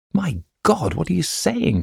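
high, shocked cry of disbelief; a touch of horror, pitch leaping up; horrified cry. A narrator's reading. Lisa reacts to Herman's confession, crying out in shock. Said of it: "cried the girl".

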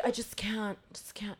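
very soft, fading mumble; muttered retold fretting. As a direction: mutter it under your breath. Retold photographer fretting over the shot.

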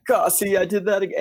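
high whiny self-scolding. Retold or imitated. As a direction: whine at yourself after a miss. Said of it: "that whiny voice that you just did".

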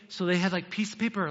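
ordinary talk

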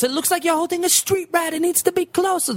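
high, cartoony Italian accent; bad Italian accent. [name] improvising in the tiny mouse scene, high bad Italian. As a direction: improvise as a squeaky fake Italian. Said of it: "bad Italian accent".

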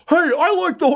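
very high interjection; hapless dissenter. Acted. Lone dissenter piping up in the mob, high voice. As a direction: pipe up in a high voice.

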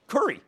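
"curry" for the name Kerry; local accent pronunciation. Shown as a demonstration. Host explaining how he pronounces Kerry, as "curry". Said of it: "the way I pronounce curry".

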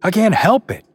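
cried out. A narrator's reading. The Oracle protesting to the guests, crying out. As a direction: cry out in protest. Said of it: "the Oracle cried".

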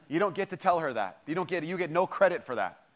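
ordinary talk, nothing marked

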